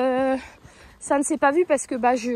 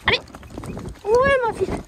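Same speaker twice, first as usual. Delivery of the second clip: très haut et fort, en selle; encouragement essoufflé; 9 semitones higher, 3 dB louder than usual. Real, not acted. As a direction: encourager en se soulevant. Debout dans les étriers, encourage. (la cavalière encourage sa jument au galop, très haut et fort)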